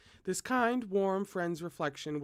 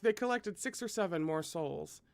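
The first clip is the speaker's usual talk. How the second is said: usual speaking voice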